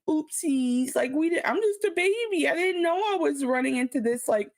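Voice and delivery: high babyish whine, playing innocent; mock baby CEO. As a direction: pout like a helpless baby. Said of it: "make it seem like".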